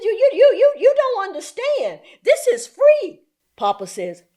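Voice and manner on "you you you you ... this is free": high, louder, sputtering insistence; flustered social worker. Retold or imitated. Social worker pleading with the grandfather, flustered. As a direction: urgently explain it, pitched way up.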